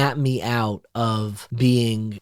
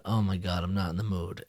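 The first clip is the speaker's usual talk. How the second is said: softer, weary under-the-breath groan; exhausted and dreading the visitor, much softer; muttered dread; 4 semitones lower, 9 dB quieter than usual. Retold or imitated. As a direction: mutter it to yourself, worn out. Tired man dreading a visitor.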